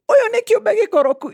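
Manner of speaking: high-pitched blurted Kikuyu line; child blurts an insult in Kikuyu about a grown-up; retold Kikuyu outburst. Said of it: "in Kikuyu".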